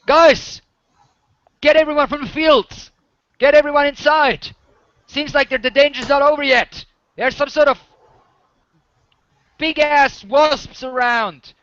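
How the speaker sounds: high, loud shouted warning. Acted. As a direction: call out urgently across the village. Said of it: "he raises his voice and says".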